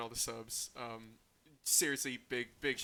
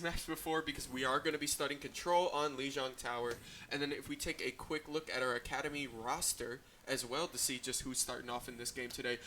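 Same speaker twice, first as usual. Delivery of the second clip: ordinary delivery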